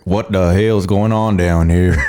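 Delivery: slow, drawled Texan; Texan accent. Shown as a demonstration. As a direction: drawl it like a cattle rancher. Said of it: "you're sounding like it".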